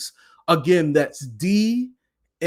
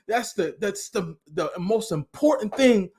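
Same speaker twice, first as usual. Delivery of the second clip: ordinary talk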